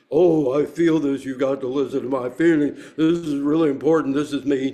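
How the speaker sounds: loud, overwrought self-important plea; mock emotional pleading. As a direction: make a hammed-up show of your precious feelings. Mocking seventies and eighties feelings talk.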